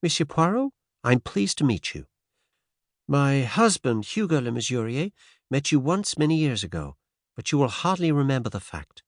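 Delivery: a hint of a transatlantic accent, polite and brisk; faint American accent. A narrator's reading. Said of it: "She spoke with a faint transatlantic accent".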